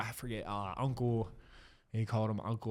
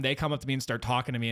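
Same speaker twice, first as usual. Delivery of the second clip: ordinary talk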